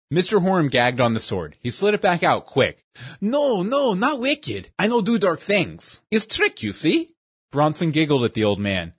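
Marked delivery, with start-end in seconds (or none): 3.2-7.1 put-on pitched-up hasty protest in a broken-English accent; flustered old magician correcting the boy in broken English; alarmed broken-English accent. Shopkeeper protests, gagging.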